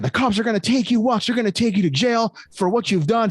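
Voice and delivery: angry dad. Retold or imitated. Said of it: "My dad was fucking so fucking pissed off".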